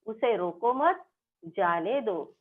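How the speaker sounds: rising उसे रोको मत, pause, falling जाने दो; pause after मत. Shown as a demonstration. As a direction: run उसे रोको मत together, then pause. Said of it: "मत के बाद चिन्ह का उपयोग किया गया है".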